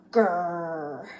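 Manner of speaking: low playful frustrated growl; teacher demonstrates the grrr, low growl; little growl. Shown as a demonstration. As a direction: growl like a grumpy grape. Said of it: "Like this".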